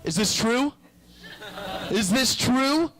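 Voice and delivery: hammed-up outraged manager; mock manager. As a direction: drag out the accusation like a stern boss. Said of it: "he'd be like".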